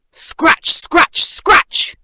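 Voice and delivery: loud, breathy scratch sounds; scratching noise. A narrator's reading. Narrator mimics the lion scratching, loud breathy scratches. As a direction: rasp out the scratching.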